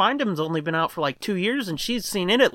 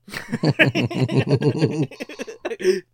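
chuckle; 4 semitones lower than usual. Real, not acted. Laughs at own joke.

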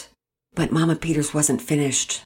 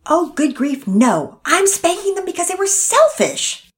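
high, loud, exasperated; good grief, fed up; wife exasperated with husband; 8 dB louder than usual. Acted.

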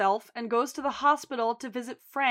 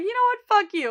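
mock outburst; 10 semitones higher, 5 dB louder, much faster than usual. Fiona teasing Lip.

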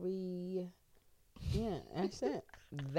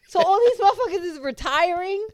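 high, loud, indignant question; real loud astonishment; incredulous; 10 semitones higher, 9 dB louder than usual. Real, not acted. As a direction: burst out in disbelief. Reacts to retirement news.

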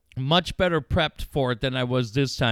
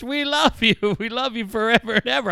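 louder, higher announcer call breaking into laughter; mock announcer yell; 8 semitones higher, 4 dB louder than usual. Host retelling the Mexican announcer's yell to America, laughing. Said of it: "Mexican announcer yelling".

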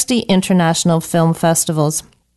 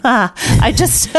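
laughing; 5 semitones higher than usual. Real, not acted. Laughing at her own confession.

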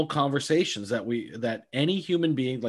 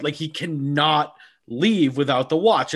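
ordinary delivery

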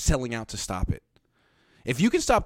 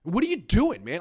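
exasperated; 9 semitones higher, slower than usual. Real, not acted. Exasperated at Derek Carr.

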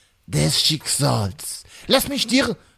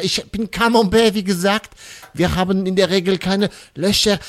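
ordinary talk, nothing marked

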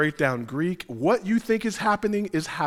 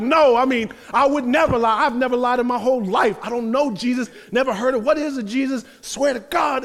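mock insistent liar; 6 semitones higher, 3 dB louder than usual.

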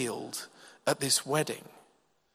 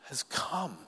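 ordinary talk, nothing marked

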